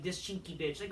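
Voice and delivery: ordinary talk, nothing marked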